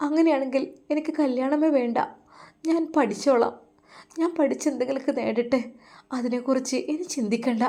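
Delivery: a daughter begging to be understood; lovesick daughter. Acted.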